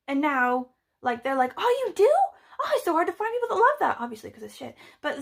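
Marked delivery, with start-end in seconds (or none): 1.6-4.0 high, quick, delighted gushing; excited fan. Retold or imitated. Excited fan replying to someone who claims to share it, thrilled. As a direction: imitate a thrilled fan finding a kindred spirit. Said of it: "be really excited about something".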